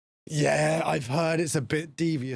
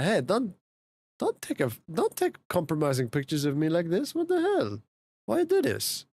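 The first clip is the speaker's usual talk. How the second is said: voicing the cat; 3 semitones higher than usual. Voicing cat caught on camera.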